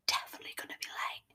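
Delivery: a quick, fast whispered aside to herself; sarcastic whisper. Real, not acted. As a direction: breathe it out under her breath, fast. Sarcastic aside about late boyfriend.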